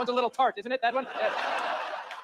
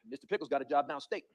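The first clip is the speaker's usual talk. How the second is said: evasive dad; 7 semitones lower than usual. Acted. Dad covering up pet death.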